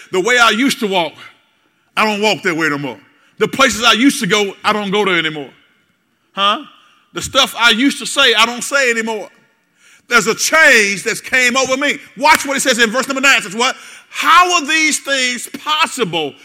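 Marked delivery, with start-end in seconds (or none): 0.1-5.5 loud testifying elder, lightly voiced; old folks testimony. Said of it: "The old folks say". 14.1-16.3 high, slow, laughing bewilderment; puzzled Nicodemus. Retold or imitated. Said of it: "Nicodemus asked".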